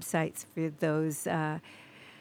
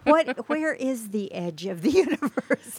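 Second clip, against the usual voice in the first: laughing; 6 semitones higher, 5 dB louder than usual. Real, not acted. Joking about the universe's edge.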